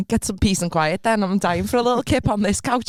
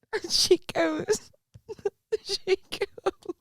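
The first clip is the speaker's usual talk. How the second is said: high, squeaky telling through real laughter; helpless giggling; 10 semitones higher, slower than usual. Real, not acted. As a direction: struggle to get it out while laughing. Cracking up while telling a story.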